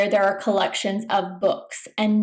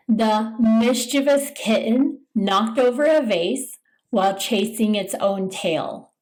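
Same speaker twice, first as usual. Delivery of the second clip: ordinary talk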